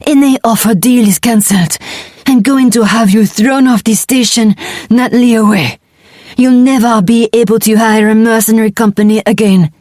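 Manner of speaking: croaking. Acted. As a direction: croak out the threat. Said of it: "he croaked".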